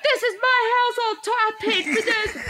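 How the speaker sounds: high mock tantrum with a laugh; imitated angry husband. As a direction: imitate a husband's indignant outburst. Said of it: "and he's like".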